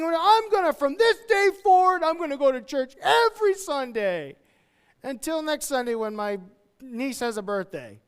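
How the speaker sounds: high, loud mock promise that fizzles at the end; fired-up vow. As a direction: ham up an overexcited vow, then deflate. Fired-up churchgoer's empty vow.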